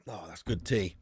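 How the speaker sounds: ordinary delivery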